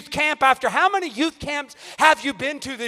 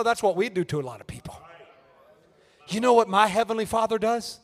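ordinary talk

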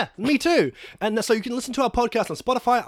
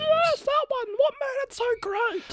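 put-on very high child squeak; little kid voice; 12 semitones higher than usual. Child character.

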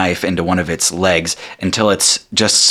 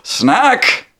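Genie savouring his meal; 2 semitones higher, much slower than usual. Acted. Genie patting his belly after eating, savouring hums. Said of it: "Genie pats his belly".

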